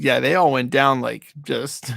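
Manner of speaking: ordinary delivery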